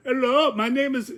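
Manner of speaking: high-pitched mock Indian accent. Shown as a demonstration. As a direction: put on an Indian tech-support accent. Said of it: "then I go Indian".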